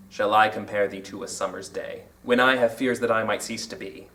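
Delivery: low, hushed verse reading; poem recitation. Shown as a demonstration. Teacher quoting examples of iambic pentameter, low poetry recitation.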